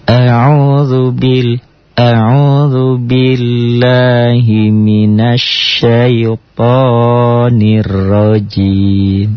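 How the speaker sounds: slow, drawn-out chanted recitation; Quran recitation. Shown as a demonstration. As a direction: recite it melodically and slowly. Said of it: "Latihan teori hentak".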